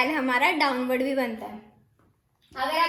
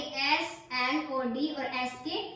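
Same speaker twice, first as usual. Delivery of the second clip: slow, letter by letter; spelling out letters; 4 dB quieter, much slower than usual. Shown as a demonstration. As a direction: spell each letter out slowly. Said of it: "spelling letters for students".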